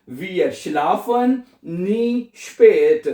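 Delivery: Wir schlafen nie spät, each word drawn out; slow teaching demo. Shown as a demonstration. Teacher modelling the German sentence, very slow. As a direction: model the sentence slowly for learners. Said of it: "क्या बनेगा?".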